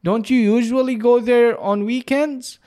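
high, slow surprised question. Retold or imitated. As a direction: sound puzzled that he's going today. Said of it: "رديت على عبد الرحمن باستغراب". (استغراب بنبرة عالية وبطيئة)

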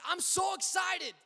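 put-on bursting with a little boy's excitement; excited child. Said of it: "He said".

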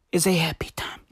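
soft whispered tag line. Acted. Vlogger to fans, whispering the tag line. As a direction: breathe it like a secret.

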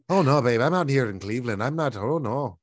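Irish lilt, brushing off his wife; dad lying to his wife in Irish accent. Retold or imitated. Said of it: "why is your Irish accent better than mine?".

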